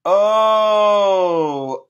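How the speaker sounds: a big shouted oh; loud cry. Acted. Starting the dog's verse for children with a loud cry. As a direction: throw out a loud opening cry.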